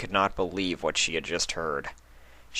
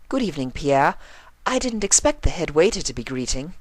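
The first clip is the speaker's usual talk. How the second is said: high, refined lady voice; pleasantly surprised greeting; Rarity's voice; 9 semitones higher than usual. A narrator's reading.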